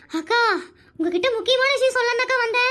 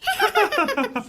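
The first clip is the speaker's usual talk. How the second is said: woman's giggle; 2 semitones higher than usual. Acted. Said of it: "இந்த சிரிப்பு சத்தத்தை எங்கேயோ கேட்ட மாதிரியே".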